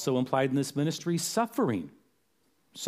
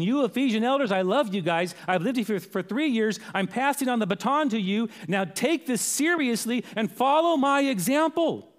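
earnest acted charge, pitched high; Paul; 8 semitones higher than usual. Apostle hands the baton to Ephesian elders, urgent appeal. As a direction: urge them earnestly, slowing into the final appeal. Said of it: "He's saying".

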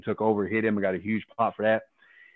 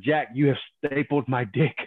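higher, louder strained plea; retold cry of pain; 5 semitones higher, 4 dB louder than usual. Telling New Jack he can't move after the staple, pained higher plea. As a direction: push the words out through pain, a touch hammed-up. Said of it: "I'm screaming in excruciating pain".

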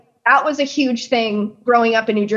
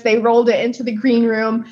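normal talking voice

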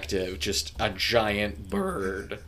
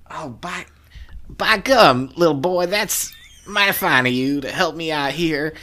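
put-on high, loud folksy old farmer voice; cranky old hillbilly, cranked up high; old prospector; 6 semitones higher, 4 dB louder than usual. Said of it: "and he's just like".

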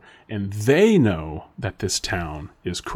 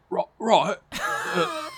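put-on a halting try at an Australian accent, breaking into a high squeak; fumbling a first Australian line, voice cracking up; attempted Australian accent; much slower than usual. Asked by a friend to do Australian, a nervous squeaky try. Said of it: "let's hear your Australian".

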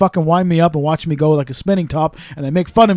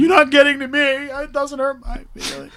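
mock wounded denial; 9 semitones higher than usual. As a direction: exaggerate a high-pitched protest, slowing down. Mimicking himself acting unbothered while teased.

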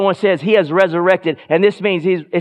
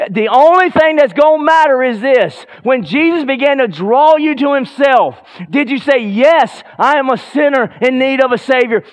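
higher and louder, building to a shouted Yes; full-force preaching; 10 semitones higher, 5 dB louder than usual. Acted. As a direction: preach it out with fire to the congregation.

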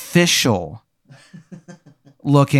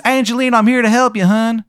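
much higher and louder hammed-up Maryland accent; sing-song Maryland accent calling through the door; bad Maryland accent; 11 semitones higher, 5 dB louder than usual. Stranger knocking calls for Angelina in a loud, high Maryland accent. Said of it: "His accent is so bad".

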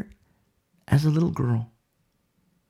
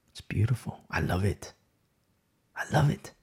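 much softer and lower, near a whisper; hushed tender; 6 semitones lower, 10 dB quieter than usual. Real, not acted. Preacher softly marveling at Deborah, hushed. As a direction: murmur it in quiet delight. Tender aside after sermon point.

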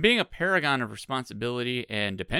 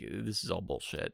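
unimpressed brother; 6 semitones lower, 9 dB quieter than usual. Retold or imitated. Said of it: "her brother had the best expression".

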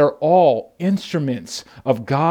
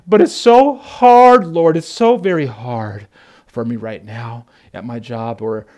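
hammed-up complaining believer; mock moan to the Lord about work; whining complaint; 3 semitones lower, 5 dB louder than usual. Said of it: "Because we often say".

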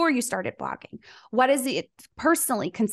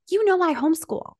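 matter-of-fact reply; 7 semitones higher, 3 dB louder than usual. Retold or imitated. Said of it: "And she's like".

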